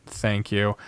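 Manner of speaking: normal talking voice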